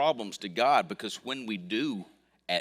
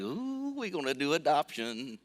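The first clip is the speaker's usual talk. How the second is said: a much higher squeal on ooh, then eager; mock excited ooh; 6 semitones higher than usual. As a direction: playfully ham up getting carried away. Retold excitement at luncheon.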